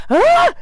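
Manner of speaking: shrill high shriek; scream of fright. Retold or imitated. She shrieks as the spider stares at her.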